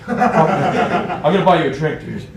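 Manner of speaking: slow, loud, through laughter; laughing punchline. Retold or imitated. Storyteller quoting the Brit's sudden change of heart, laughing. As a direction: crack up while delivering the quote.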